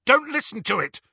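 high, loud urgent command; harsh. A narrator's reading. Harry warns Ron against the Horcrux, harsh. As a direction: cut harshly across the voice. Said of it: "Harry said harshly".

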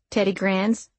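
plain reading voice

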